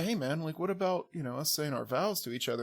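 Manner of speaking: hushed aside to the officiant; quietly, confidentially prompting someone beside you; discreet aside. Retold or imitated. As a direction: drop the voice for a discreet nudge. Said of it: "I elbow him and say".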